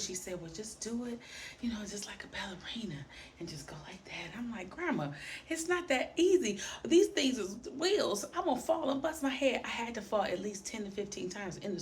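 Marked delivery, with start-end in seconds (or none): 0.4-4.4 hushed grandma coaxing. Retold or imitated. Grandma coaxing child in store. 4.7-9.6 put-on high, whiny kid complaining; a scared little girl begging off the skates; whiny child protest. Girl whining to grandma about falling on skates.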